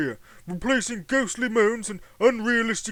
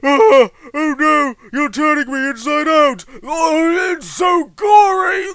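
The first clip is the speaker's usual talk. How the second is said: loud high shrieking, hammed-up pain; screaming in agony; 8 semitones higher, 12 dB louder, slower than usual. Victim turned inside out, hammed-up screaming. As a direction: wail like you're being torn apart.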